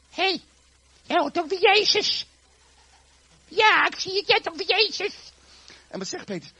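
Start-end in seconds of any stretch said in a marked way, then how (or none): 0.1-5.1 put-on high, loud little-girl squeal. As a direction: accuse him in a piping child's voice. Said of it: "dat kleine meisje zegt".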